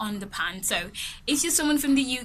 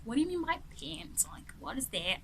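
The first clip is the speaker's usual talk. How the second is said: mock American accent; 5 semitones higher, 9 dB quieter than usual. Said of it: "trying to make fun of the American accent".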